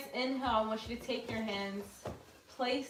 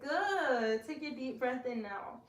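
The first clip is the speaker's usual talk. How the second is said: ordinary delivery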